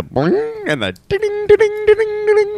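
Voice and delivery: high-pitched boing and coin dings; imitated game noises. Asking about singing Mario music to the baby, imitated game noises. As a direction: mimic video-game coin chimes, way up high. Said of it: "Do all the sound effects".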